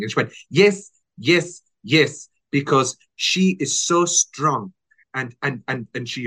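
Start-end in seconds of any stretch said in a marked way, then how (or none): 0.5-4.7 emphatic Spanish hostess, drawn out; slow, insistent yeses in a Spanish accent. Retold or imitated. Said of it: "your Spanish accent could use some work".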